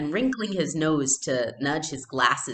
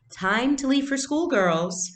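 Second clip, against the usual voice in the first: mother calling out; 11 semitones higher, 6 dB louder, slower than usual. A narrator's reading. Mother calling girls to school.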